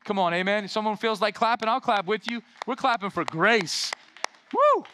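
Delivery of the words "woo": high joyful cheer; loud high celebratory woo; excited whoop. Real, not acted. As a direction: whoop it up for grace. Pastor cheering with applause.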